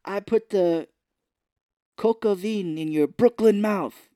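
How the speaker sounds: loud, high, slow mock tirade; Robin Williams. Ousted host berates the man he made, loud mock outrage. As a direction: belt it out as a hammed-up rant.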